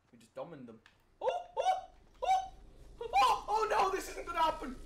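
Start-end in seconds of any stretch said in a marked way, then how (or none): 1.2-4.8 panicked. Real, not acted. Team about to die.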